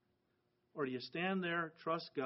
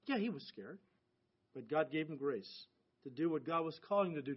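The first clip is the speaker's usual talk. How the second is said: normal talking voice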